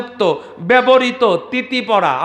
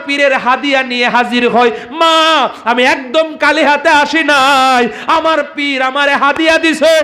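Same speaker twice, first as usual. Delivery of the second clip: preaching at full force; 10 semitones higher than usual. Acted. Preacher telling a saint's story to the crowd, at full force. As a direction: call it out to the crowd like a village waz.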